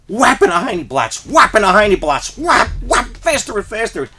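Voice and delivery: high, loud, rushing recount; frantic retelling. Retold or imitated. Comic storyteller reliving the teacher's paddling, frantic and loud.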